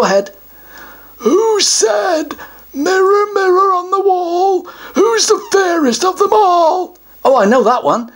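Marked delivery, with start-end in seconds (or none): 1.2-7.0 pompous, higher troll voice; a self-important troll puffing himself up; troll guardian. Acted. Troll announces he guards the treasure, pompous higher voice.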